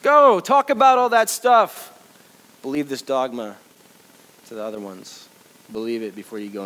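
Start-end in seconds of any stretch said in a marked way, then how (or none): 0.0-2.0 much higher, louder, drawn-out order; mock slow shout. Pretend Jesus dismissing disciples, slow loud shout. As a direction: stretch the command out loud. Mock command repeated slowly.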